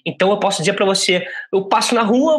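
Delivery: usual speaking voice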